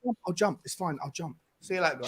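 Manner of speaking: muttered as he leaves; sulky withdrawal. Real, not acted. Threatens to leave the call.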